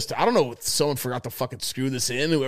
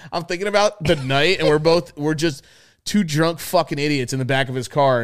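ordinary talk

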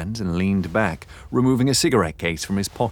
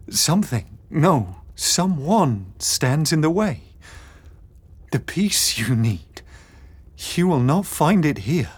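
eerie medium voice; 7 semitones higher than usual. Acted. Medium in trance warns him.

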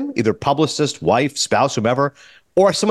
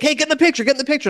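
mock calling out; 11 semitones higher, faster than usual. Woman calling people over.

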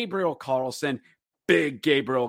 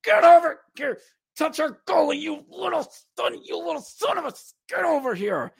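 angry challenge; 13 semitones higher than usual. Retold or imitated. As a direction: yell him over like an enraged teammate. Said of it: "Cale Kessy goes".

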